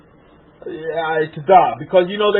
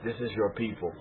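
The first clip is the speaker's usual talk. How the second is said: much softer, hushed quoting of a voice heard; soft inner voice; 9 semitones lower, 15 dB quieter than usual. Retold or imitated. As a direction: murmur it like a voice from within. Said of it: "I heard a feminine voice say".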